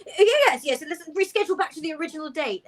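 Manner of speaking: high, loud, rushed yes; eager agreement. Acted. Humiliated Richard hurriedly agreeing to reschedule, high and fast. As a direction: blurt it out, desperate to escape. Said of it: "And he's like".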